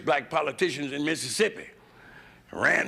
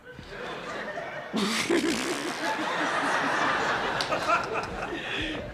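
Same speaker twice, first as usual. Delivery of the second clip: cackle; 12 semitones higher than usual. Real, not acted. Big cackle.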